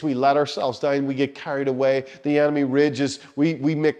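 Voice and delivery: usual speaking voice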